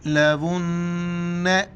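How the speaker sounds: लवुन्न chanted with the double n held long in the nose; nasal ghunna. Shown as a demonstration. Teacher demonstrating nasal sound, held ghunna in लवुन्न. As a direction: hum through the न्न of लवुन्न before releasing it. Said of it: "नाक में आवाज़ पैदा करनी है".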